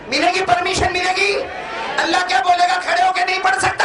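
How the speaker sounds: high, ringing call to the crowd; full-force preaching. Acted. (जोशीली तक़रीर, ऊँची आवाज़ में)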